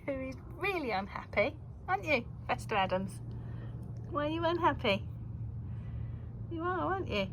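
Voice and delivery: high coaxing voice to the cat; pet talk. Real, not acted. Owner coaxing Fester who is looking daggers. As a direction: coax the grumpy cat sweetly.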